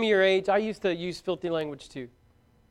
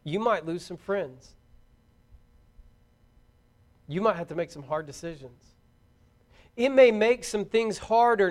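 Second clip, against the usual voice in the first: earnest preaching appeal; 2 semitones lower, slower than usual. Real, not acted.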